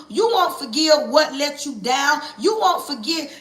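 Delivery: ordinary talk, nothing marked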